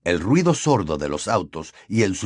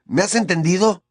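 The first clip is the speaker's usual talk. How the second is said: high, pressing demand; tense, raised question; threatening; 9 semitones higher than usual. A narrator's reading. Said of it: "lo amenazó Harry".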